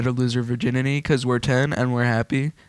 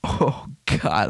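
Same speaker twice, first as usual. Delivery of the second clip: ordinary talk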